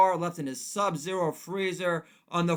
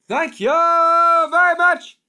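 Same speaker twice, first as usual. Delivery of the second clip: high, loud and slow; over-the-top comic sign-off; 13 semitones higher, 15 dB louder, slower than usual. Acted. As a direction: belt it like a lounge comic. Catchphrase, stand-up parody.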